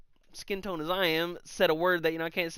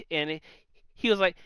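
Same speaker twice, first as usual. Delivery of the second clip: ordinary talk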